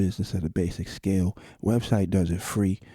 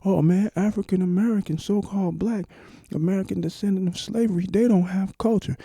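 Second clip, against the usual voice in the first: mocking dismissive quote; 11 semitones higher, 4 dB louder than usual. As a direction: parody the naysayers, pitched way up and louder. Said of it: "So for people to say".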